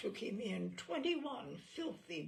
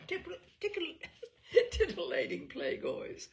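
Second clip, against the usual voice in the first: laughing; 9 semitones higher, 4 dB louder than usual. Real, not acted. Giggling over a tongue-twisting word.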